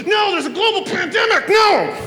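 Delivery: high, loud panicked shout. Pretend. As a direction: yell it like disaster just struck. Pandemic interrupts relay.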